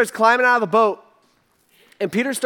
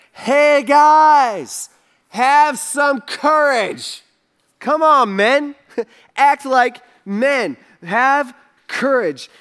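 loud, slow rallying call; rally the men to courage; urgent shout of encouragement; 4 semitones higher, 5 dB louder, much slower than usual. Retold or imitated. Jesus calling out to disciples.

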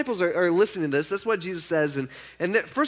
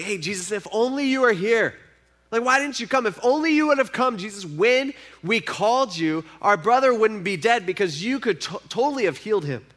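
imitated distraught plea, pitched up, no tears; retold grief as high-pitched complaint; devastated pleading; 6 semitones higher, 3 dB louder than usual. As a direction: plead with mild reproach, voice rising. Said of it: "they're crying, and they're devastated".

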